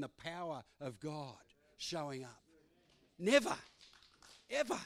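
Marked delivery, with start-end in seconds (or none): 3.2-4.8 Never called out high and loud; shouted never. Real, not acted. Preacher shouting Never at the church, high and loud. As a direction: belt Never out to the congregation.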